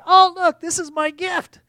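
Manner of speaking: high, loud burst of delight; mock gift excitement. Preacher mimics someone pulling out a present, excited. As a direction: exclaim it like a thrilled present-opener.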